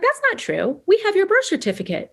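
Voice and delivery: a hint of the cousin, pitched well up; cousin's voice.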